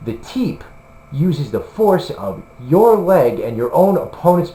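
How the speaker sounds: hammed-up excited TV host explaining pseudo-science; Jason Chambers, excited. Vlogger imitates Jason Chambers describing a teep, really excited voice. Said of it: "in a really excited voice".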